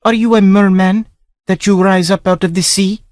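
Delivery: put-on high, wondering young woman's voice; Sancha asking. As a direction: ask him like a fascinated lady. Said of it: "she asked".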